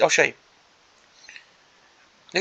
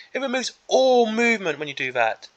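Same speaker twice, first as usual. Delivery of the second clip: ordinary talk, nothing marked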